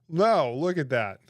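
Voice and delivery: loud, slow horrified cry; horrified outburst. Real, not acted. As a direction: recoil loudly at the picture. Horrified by spider photo.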